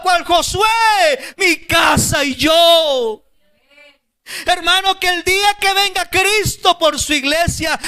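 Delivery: fiery pulpit climax; full-force preaching. Acted. Sermon climax, impassioned exhortation.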